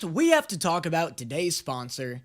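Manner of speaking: ordinary delivery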